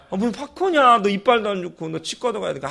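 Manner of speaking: high, exasperated refusal then calming; a father refusing popcorn, exasperated; scolding dad. Retold or imitated. Dad scolding son over popcorn.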